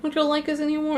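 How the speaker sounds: high-pitched mock little-kid pleading; put-on cutesy kid voice, high and pleading. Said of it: "cutesy kid thing".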